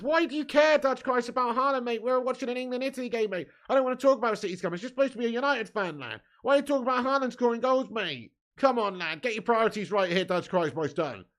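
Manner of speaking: mock exasperation. Mock-exasperated rant at a chatter.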